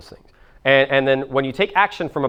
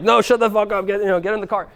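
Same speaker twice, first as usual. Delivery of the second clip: mock parental outburst, pitched up; stressed-out parent; 8 semitones higher than usual. Stressed parent ordering a kid into the car, mock-angry. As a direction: act out a parent losing it. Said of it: "super fucking stressed out".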